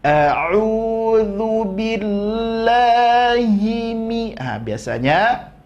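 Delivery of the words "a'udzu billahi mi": slow chanted a'udzu billahi; Quran recitation. Shown as a demonstration. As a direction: recite it like tajwid practice. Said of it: "di lafaz Allah". (bacaan tartil pelan)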